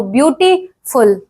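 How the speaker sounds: ब्यूटीफुल broken as "beauty - ful"; ब्यूटीफुल in separated chunks, as a syllable demo; split into syllables. Shown as a demonstration. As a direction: pause before the last part of ब्यूटीफुल. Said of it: "syllables ऐसे होता है, देखो".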